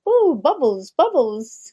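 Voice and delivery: lightly voiced southern French sparkle; bubbly southern French. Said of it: "they speak as if it's bubbles".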